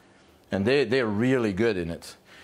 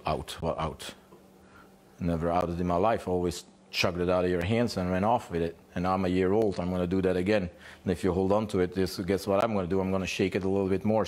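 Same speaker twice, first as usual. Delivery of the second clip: defiant dog; 4 semitones lower than usual. Acted. Dog answers owner's command to let go, low and defiant. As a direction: play the dog refusing, pitched down.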